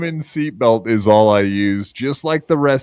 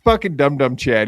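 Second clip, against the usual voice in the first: ordinary delivery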